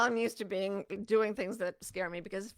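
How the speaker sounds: ordinary talk, nothing marked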